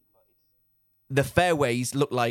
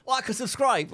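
higher-pitched mock podcast plug; lightly put-on promotional intro, pitched up; mock self-promoter; 6 semitones higher than usual. Podcaster mocking how he'd plug his show to strangers, mock self-promoter. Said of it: "I'm not going out saying".

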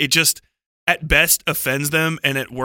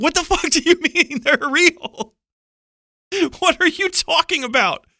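high-pitched quote cracking into real laughter; much higher, laughing, can't believe it; laughing disbelief; 13 semitones higher than usual. Real, not acted. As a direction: laugh through the question in disbelief. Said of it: "we literally laughed him down".

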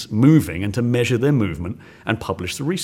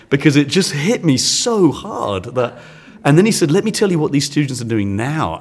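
still tearful; 5 semitones higher, 4 dB louder than usual. Real, not acted. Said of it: "I'm ugly crying now".

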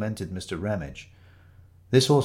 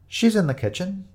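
bright, higher cheerful reply; 10 semitones higher than usual. Acted. Orange-haired woman answers the door, cheerful. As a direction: greet the strangers brightly, a touch higher. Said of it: "said the woman cheerfully".

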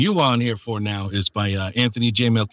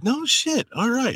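surprised; 14 semitones higher, slower than usual. Retold or imitated. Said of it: "I was just like".

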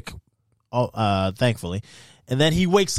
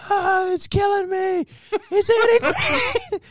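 very high, slow mock wail; mock screaming victim; slower than usual. Imitating the bear victim on the tape for friends, high hammed-up wail. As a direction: wail it like a man being mauled, hammed-up.

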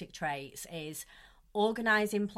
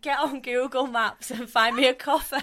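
higher, louder mock demand; raised, laughing retold order; laughing imitation; 6 semitones higher, 8 dB louder than usual. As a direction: imitate a bossy request through laughter. Retelling model's demand while laughing.

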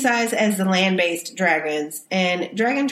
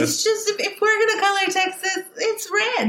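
amused; 13 semitones higher than usual. Real, not acted. Joking about Texas politics.

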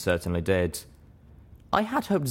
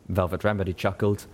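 ordinary delivery, nothing marked

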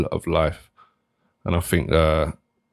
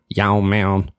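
drawn-out, hammed-up American greeting; exaggerated American twang; put-on American accent; 2 semitones higher than usual. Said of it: "see that American accent?".

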